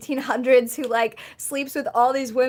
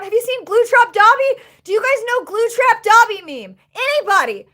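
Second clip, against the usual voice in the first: excited shout; 7 semitones higher, 6 dB louder than usual. Real, not acted. Excited shouting about a meme.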